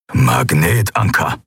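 low, slow growl. Acted. Keru identifies the enemy's anchor, growling. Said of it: "knurrte Keru".

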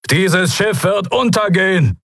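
high-pitched alarm call; urgent calling. A narrator's reading. Admiral orders crew off ship. (hoher, dringlicher Warnruf)

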